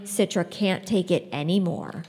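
ordinary delivery, nothing marked

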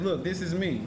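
ordinary delivery

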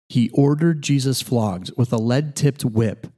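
usual speaking voice

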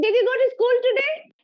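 fast, loud, high shrill question. Shown as a demonstration. Host quizzing the third grader in a test, loud and shrill. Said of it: "continue a conversation in a loud and a shrill voice".

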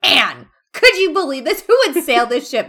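loud, high-pitched mock disbelief; hammed-up incredulous outburst; fanboying. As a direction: play the worked-up fanboy. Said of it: "he's very clearly fanboying over this".

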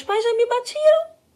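high, loud, slow fake cry; mock sobbing. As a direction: whimper it out like a hammed-up crybaby. Jokes about being spanked as child.

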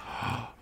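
soft, quick intake of breath; shocked gasp. Real, not acted. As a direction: gasp at the reveal. Shocked by the texting reveal.

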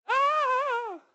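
high startled shriek; panicked yelp. Retold or imitated. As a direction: recoil from the task in mock terror. Imitated panic about loving neighbours.

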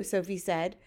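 plain reading voice

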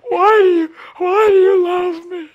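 high, loud sobbing plea; imitated tearful woman, high and loud; crying woman. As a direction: break down asking why. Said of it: "She was crying uncontrollably".

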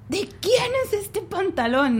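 exasperated question. Retold or imitated. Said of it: "y yo así".

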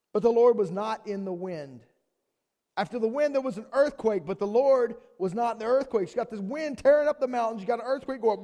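high, loud preaching read; loud impassioned Bible reading; forceful scripture reading. A narrator's reading. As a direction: proclaim the verse at full volume.

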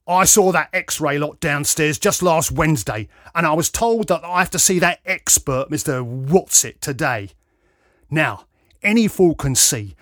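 loud, forceful rant; snarling complaint. A narrator's reading. Patient insisting appointment is urgent, snarling complaint. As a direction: lay it out angrily, pushing hard. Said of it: "he snarled".

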